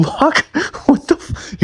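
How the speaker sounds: high laughing gasp; laughing disbelief. Real, not acted. Reviewer seeing Nagi bring snacks to camp, laughing.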